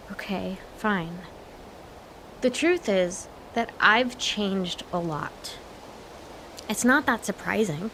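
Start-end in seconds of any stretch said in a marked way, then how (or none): 0.1-5.6 whispered, slow giving in; a slow, hushed admission to her dad; hushed confession. Acted. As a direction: let it out softly, almost a breath. Daughter gives in, confesses.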